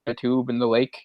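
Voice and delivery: usual speaking voice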